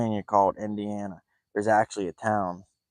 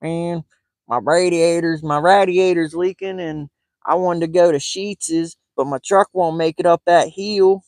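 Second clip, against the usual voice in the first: much higher and louder, "RAD-iator's", "Sheetz's", "heel"; mock local guy; 8 semitones higher, 7 dB louder than usual. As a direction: ham up the local guy's complaint. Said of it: "he's like".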